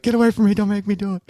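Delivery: high-pitched mock panic of a little sister; imitating sister. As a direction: cry it out high, as a cornered kid sister. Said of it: "close her eyes and be like".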